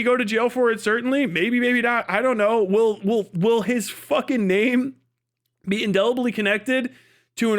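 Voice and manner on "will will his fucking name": usual speaking voice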